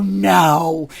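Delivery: loud, acted-out refusal; emphatic mock denial. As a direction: protest loudly, imitating your own denial. Retold loud denial to friend.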